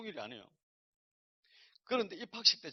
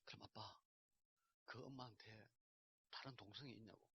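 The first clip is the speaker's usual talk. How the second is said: put-on teary child's question; 5 semitones lower, 20 dB quieter than usual. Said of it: "눈물이 글썽글썽하면서".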